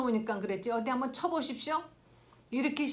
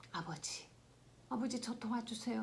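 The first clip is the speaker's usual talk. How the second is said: whispered prayer; 7 dB quieter than usual. Pretend. Acted whispered prayer to God.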